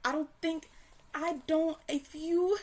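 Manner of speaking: loud and high, sputtering in disbelief; can't even finish the thought; half-shouted indignant splutter; exasperated outburst. Real, not acted. Outraged rant about Lemonade.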